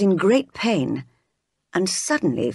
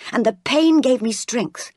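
plain reading voice

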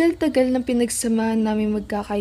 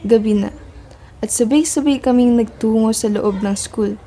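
louder story narration, building suspense; dramatic narration; 8 dB louder than usual. A narrator's reading. Narrator setting the night scene, loud and dramatic. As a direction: tell it like a thriller voiceover.

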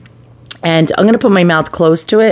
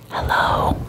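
Hello breathed in a soft unvoiced whisper; ASMR whisper; 9 dB quieter than usual. Shown as a demonstration. Showing viewers how ASMR sounds, whispering. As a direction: whisper Hello close to the mic. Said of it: "But if you wanna do a A S M R".

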